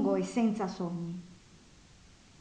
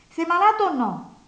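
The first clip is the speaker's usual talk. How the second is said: impatient demand; 7 semitones higher, 7 dB louder than usual. A narrator's reading. Nastasia presses him impatiently.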